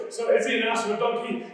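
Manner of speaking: ordinary talk, nothing marked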